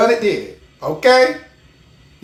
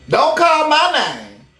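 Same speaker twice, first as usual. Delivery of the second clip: ordinary talk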